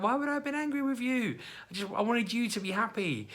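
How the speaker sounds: very high put-on soothing voice; reassuring.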